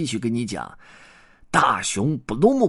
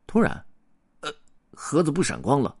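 plain reading voice

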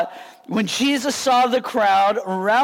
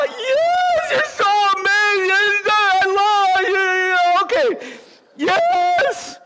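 high, gushing, starstruck voice; swooning fan; 8 semitones higher, slower than usual. Pretend. Pastor pretending to gush over Russell Wilson, high and swooning. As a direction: melt like a teenager meeting an idol. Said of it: "I got a little crush on him".

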